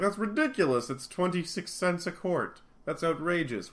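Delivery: high-pitched, affronted outburst; mock indignation. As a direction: protest as if scandalized by the price. Wonder Woman indignant at milk price.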